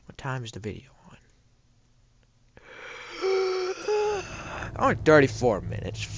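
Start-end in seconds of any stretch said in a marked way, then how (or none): 2.8-4.7 long, high drawn-in gasp; big startled gasp; shocked gasp. Real, not acted. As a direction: suck in air in exaggerated shock. Sees the video time.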